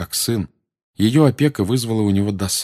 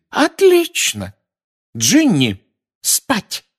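very high, loud, slow; mother sending Ginny to bed; angrier shout; 5 dB louder, much slower than usual. Acted. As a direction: drag it out in fury.

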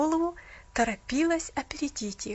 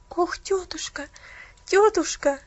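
pleading; 5 semitones higher, 5 dB louder than usual. Acted. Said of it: "взмолилась Полианна со слезами на глазах".

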